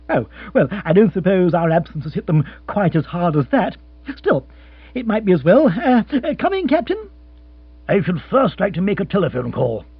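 chirpy lord offering comfort; young lord voice. Acted.

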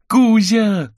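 put-on high, loud delighted child's cry; overjoyed. Boy spots his friend Kuzya, overjoyed high shout. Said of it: "обрадовался Миша".

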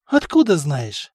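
slow, mildly surprised question. A narrator's reading. Stefan to wounded brother, mildly surprised. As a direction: draw out the question in mild wonder. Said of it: "удивился Стефан".

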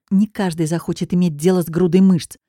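plain reading voice

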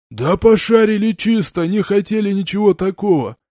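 high, loud whiny gopnik; russian street thug. A narrator's reading. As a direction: make excuses like a street punk. Gopnik excusing himself. (гопник, оправдываясь)

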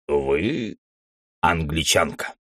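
a hint of a knowing cat. A narrator's reading. Cat guesses her nationality.

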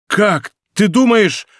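high, stricken question; anguished cry, breaking off. A narrator's reading. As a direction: blurt it out in horror, cut short. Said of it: "Голос его пресёкся рыданием".

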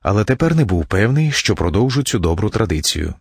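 plain reading voice

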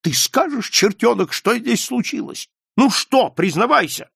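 high, loud angry demand; furious. A narrator's reading. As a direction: tear into your son for a confession. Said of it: "Скалли яростно накинулся на сына". (яростно, на крике)

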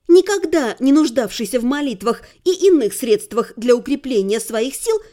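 ordinary delivery

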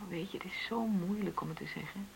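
soft, breathy, hard to get out; hesitant whisper. Acted. Wife to husband about money, faltering whisper. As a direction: barely dare to say it.

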